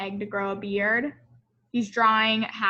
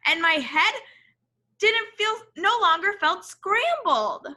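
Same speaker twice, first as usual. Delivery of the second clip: story narration; 6 semitones higher, 4 dB louder than usual. A narrator's reading. Reads picture book aloud.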